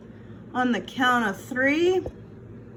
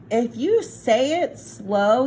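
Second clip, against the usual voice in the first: ordinary delivery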